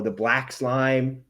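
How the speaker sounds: ordinary talk, nothing marked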